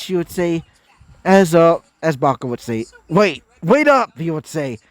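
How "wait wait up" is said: higher, drawn-out call after someone. Acted. Bakugo calling after friends leaving, higher and drawn out. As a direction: stretch it out to catch a friend walking away.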